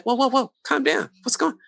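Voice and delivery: imitating a startled friend soothing him; mock alarmed calming. Friend retelling calming the jealous guy, alarmed.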